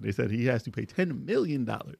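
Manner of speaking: normal talking voice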